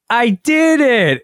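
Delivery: put-on high, slow, delighted little-kid "I did it"; adorable toddler voice. Dad imitating his son's proud toddler cheer. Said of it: "in, like, the most adorable voice".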